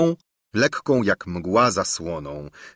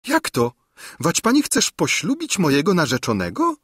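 dismayed outcry; 9 semitones higher than usual. A narrator's reading. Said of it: "zawołała biedna Róży czka".

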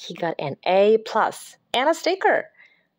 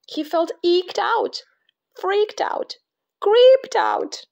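climbing higher and louder each phrase; rising panic; 10 semitones higher, 3 dB louder, slower than usual. A narrator's reading. Narrator building Jasper's panic to a squeal.

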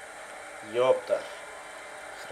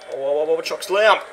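rising hype shout, higher and louder; excited call; 5 semitones higher, 11 dB louder than usual. Real, not acted. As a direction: yell the move as it lands. Live wrestling game call.

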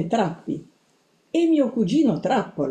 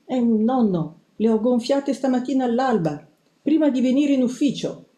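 high-pitched, nervous squeaky reply; timid mouse squeak; agitated squeak; 6 semitones higher than usual. A narrator's reading. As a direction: squeak anxiously to reassure your grandfather. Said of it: "io squittii".

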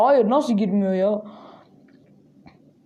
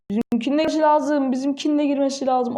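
normal talking voice

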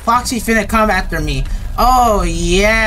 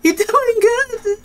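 very high, squeaky sweet-talk; mock-friendly greeting; 14 semitones higher than usual. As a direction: coo sky-high at the monster to placate it. Greets Foxy again to placate him.

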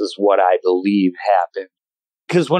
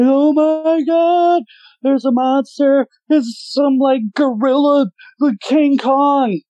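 breathless, much higher mock panic; panicked kid. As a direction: squeal it out like a terrified kid who just saw a monster. Retells own panicked report of monster.

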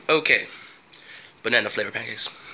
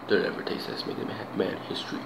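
hushed murmur; 5 semitones lower, 8 dB quieter than usual. Real, not acted. Savoring food, murmuring to self.

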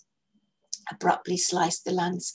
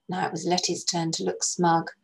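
ordinary delivery

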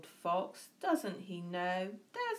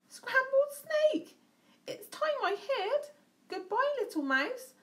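high, loud, slowed alarm; frightened snake; 5 dB louder than usual. A narrator's reading. Snake fleeing the mouse, loud high alarm. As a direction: shriek it like a scared snake. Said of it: "away snake slid".

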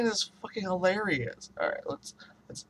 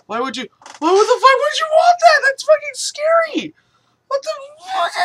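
freaked-out yell; 11 dB louder than usual. Real, not acted. Freaked out by face-tracking webcam.

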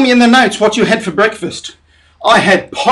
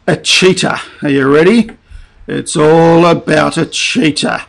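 usual speaking voice

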